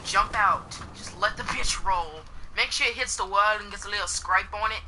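playful put-on English accent. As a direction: chat along in a jokey English accent. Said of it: "I'm just gonna talk in this English voice".

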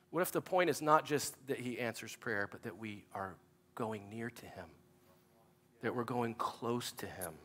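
very soft, low and slowing; hushed earnest. Real, not acted. Pastor on drawing near to God, hushed and earnest. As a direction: drop to a near-hush for the key point. Preacher softens for key point.

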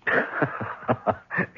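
short breathy scornful laugh; mocking laugh. Businessman laughing at blackmailer's worthless check, scornful. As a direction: laugh him off, contemptuous.

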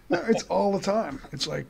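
ordinary talk, nothing marked

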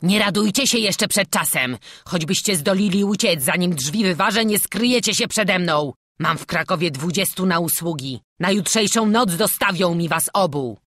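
loud, angry robber; roaring threats. A narrator's reading. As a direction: menace the boys at full voice. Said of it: "ryczał pod ziemią zbój".

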